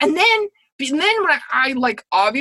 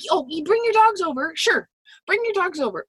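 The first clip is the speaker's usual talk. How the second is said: mock welcoming; 7 semitones higher than usual. Mock welcome before "Don't bring your dog.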